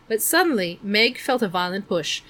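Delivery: ordinary delivery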